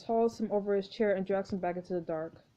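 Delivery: ordinary talk, nothing marked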